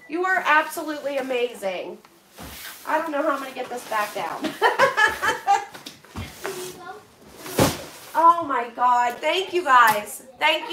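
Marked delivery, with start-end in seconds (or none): 4.6-5.9 high rippling burst of laughter; helpless laugh. Real, not acted. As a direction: laugh at your own predicament. Amused at the overfull box.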